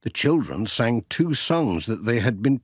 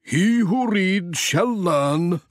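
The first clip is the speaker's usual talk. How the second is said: slow, higher solemn vicar voice; Reverend Timms; 9 semitones higher, slower than usual. Acted. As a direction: intone it like a wise sermon line. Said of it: "said the Reverend".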